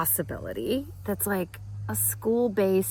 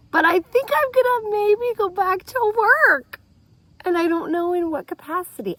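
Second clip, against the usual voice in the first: excited announcement; 10 semitones higher, 5 dB louder than usual. Real, not acted. Sudden high declaration.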